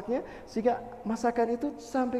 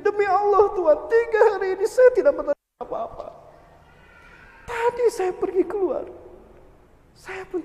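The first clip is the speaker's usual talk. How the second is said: higher, louder anguished plea; distressed oath; 9 semitones higher, 5 dB louder than usual. Acted. Widow swears to the shoemaker, higher anguished plea. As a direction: swear it desperately.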